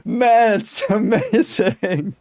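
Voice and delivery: high, loud, laughing delight; excited. Real, not acted. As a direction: gush over it with a laugh. Thrilled by the naming.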